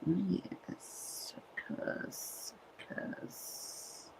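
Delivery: fading whisper. Real, not acted. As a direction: whisper it, dying away. Whispered repeat.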